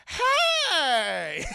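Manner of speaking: high, drawn-out shout; mock shout. Retelling Ash in the film, mock shout. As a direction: stretch out a high "hey". Retold startled film character.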